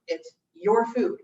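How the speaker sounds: "your" stressed, slow and deliberate. Real, not acted. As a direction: lean on "your", drawn out. Urging viewers to cook freely.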